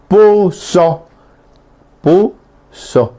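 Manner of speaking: "puso" with a sharp catch in the throat between the syllables; demonstrating the Guaraní glottal stop. Shown as a demonstration. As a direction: cut the sound off mid-word, "pu" then "so". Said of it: "el puso le digo en castellano".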